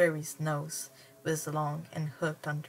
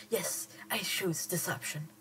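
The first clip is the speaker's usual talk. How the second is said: whisper; 3 dB quieter than usual. Acted. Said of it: "unvoiced whisper".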